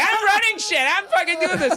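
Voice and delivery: high, bossy soccer-mom squawk; soccer mom impression. Mocking the lady who cut the mic, in a high bossy soccer-mom voice. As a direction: play the bossy mom running the show. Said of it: "she was like this soccer mom".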